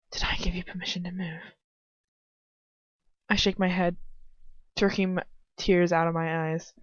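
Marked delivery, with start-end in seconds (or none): 0.1-1.4 cold fast whisper; whispered menace. A narrator's reading. Captor whispers coldly to pinned girl. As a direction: hiss the threat under the breath. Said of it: "his face cold, hard and cold".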